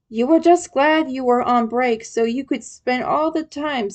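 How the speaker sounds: plain reading voice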